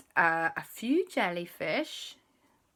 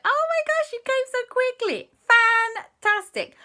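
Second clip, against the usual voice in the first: very high, loud gushing; thrilled JB; 8 dB louder than usual. Acted. JB welcoming the kids to her lab, very high and gushing. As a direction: gush with delight.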